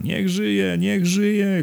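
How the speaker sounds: high-pitched cheer at storyteller volume; crowd's cheer. A narrator's reading. The crowd takes up the hurrah, high cheering cry. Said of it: "krzyknął tłum".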